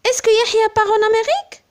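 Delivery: high rising tune ending on Amérique, fast; rising question. Shown as a demonstration. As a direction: ask it brightly, lifting Amérique. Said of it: "Par exemple".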